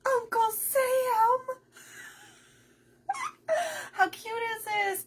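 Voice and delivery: high, loud, slow squeal of delight. Real, not acted. Crafter unboxing a nutcracker from a friend, squealing. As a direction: gush over the gift.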